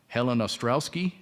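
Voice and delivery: ordinary talk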